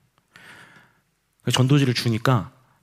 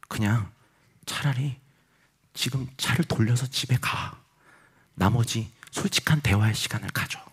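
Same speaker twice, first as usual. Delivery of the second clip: inner voice; 7 dB quieter than usual. Retold or imitated. Inner voice telling the pastor to go home, hushed. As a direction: speak softly, like a thought in the head. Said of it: "제 안에 이런 소리가 들리는".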